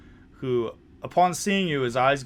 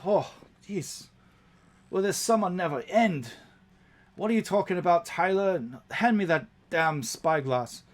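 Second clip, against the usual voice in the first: higher, irritable gnome grumbling; a cranky captain fed up with the heat; grumpy gnome captain; 6 semitones higher than usual. Acted. Captain Biam snaps at goblin Tyler, higher and grumpy.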